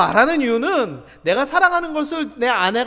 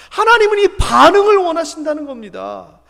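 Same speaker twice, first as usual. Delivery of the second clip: normal talking voice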